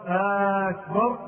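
long "آه" opening "أكبر"; lengthened hamza. Shown as a demonstration. Teacher illustrates the quoted ruling, first hamza lengthened. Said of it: "أو لفظ أكبر".

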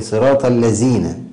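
الذين with z in place of dh; tongue kept in. Shown as a demonstration. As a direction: say الذين as "allazīna". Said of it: "عدم إخراج اللسان".

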